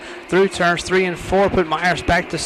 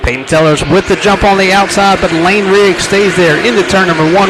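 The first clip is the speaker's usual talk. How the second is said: excited race call; 9 dB louder than usual. Real, not acted. Live race call, green flag.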